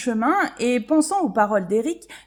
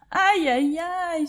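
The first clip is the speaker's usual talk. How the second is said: higher whining ouch cries; a lighter repeated moan; complaining ouch; 7 semitones higher, slower than usual. Acted. Dazed scarab still moaning to the worm, light whine. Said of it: "se plaint Vladimir".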